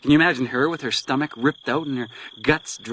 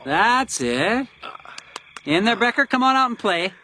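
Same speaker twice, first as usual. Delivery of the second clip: higher, mocking sing-song wake-up call; taunting; 6 semitones higher than usual. Captor mocking the chained detective awake, sing-song taunt. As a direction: coax him awake like a cruel game.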